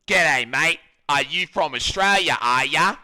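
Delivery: loud, exaggerated Aussie accent; mock Australian accent. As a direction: ham up a broad Australian greeting. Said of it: "pretending to be Australian".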